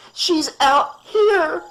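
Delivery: very high, slow, tearful cry; distraught mother crying out to the doctor. Acted. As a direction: cry it out through sobs. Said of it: "Missus Shepard cried".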